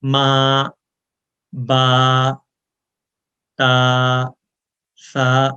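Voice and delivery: one alif length. Shown as a demonstration. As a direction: count out each syllable one alif long. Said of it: "اس time کو ایک الف time کہتے ہیں".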